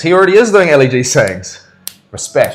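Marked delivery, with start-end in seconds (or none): 2.1-2.5 LEG catchphrase. Shown as a demonstration.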